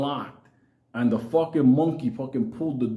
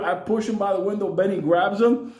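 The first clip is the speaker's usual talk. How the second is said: ordinary delivery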